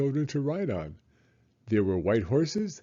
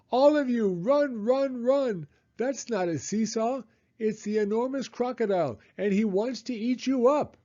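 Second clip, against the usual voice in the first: high, raised frantic call; urgent monkey warning; 11 semitones higher than usual. A narrator's reading. Monkey warns children of the crocodile, frantic call. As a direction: hurry them away from danger. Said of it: "Mugglewump shouted".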